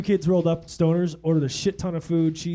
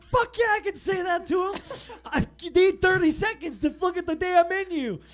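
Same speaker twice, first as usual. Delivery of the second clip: high, defiant comeback, half laughing; defiant retort; 13 semitones higher than usual. Retold or imitated. Telling Kim off for scolding him, high and defiant. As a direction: fire it back, high and cocky.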